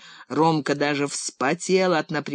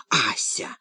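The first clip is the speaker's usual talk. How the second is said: reproving; 5 semitones lower than usual. A narrator's reading. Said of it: "одёрнула Липа соседку".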